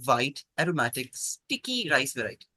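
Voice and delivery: ordinary delivery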